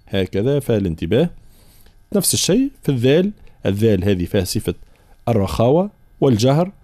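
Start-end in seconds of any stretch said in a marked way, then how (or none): none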